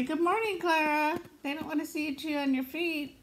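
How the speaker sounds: high sing-song doggy voice; fond high-pitched greeting; cooing pet talk. Real, not acted. Talking to the pet dog.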